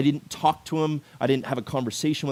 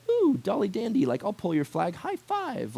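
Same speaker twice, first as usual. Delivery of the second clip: high, sing-song mock of a carefree flag football player; mock casual player; 8 semitones higher than usual. Mocking laid-back flag players who just want fun, sing-song high. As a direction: lampoon the players who don't take it seriously. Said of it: "everybody's like".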